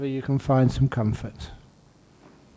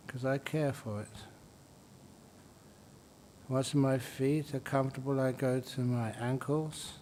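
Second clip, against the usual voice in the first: soft, unhurried meditation voice with long pauses; guided meditation; 8 dB quieter than usual. Real, not acted. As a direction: gently guide them through the body, softly. Leads a body-scan meditation.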